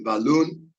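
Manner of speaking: ba-LOON, weight on the last syllable; stress on second syllable of balloon. Shown as a demonstration. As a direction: lift and punch the LOON in balloon. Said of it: "which is the second syllable stressed".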